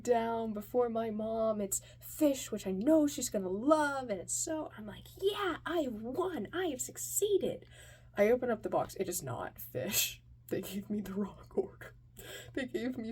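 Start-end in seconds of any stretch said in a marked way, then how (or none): 5.2-7.6 high, triumphant declaration; victorious. Retold or imitated. Kid delivering food to mom, victorious. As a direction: crow over your win.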